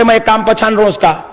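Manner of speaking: ordinary talk, nothing marked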